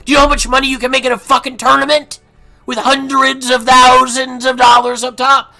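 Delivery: loud high furious shout; angry yell. Real, not acted. Yelling at a poker app seller, furious.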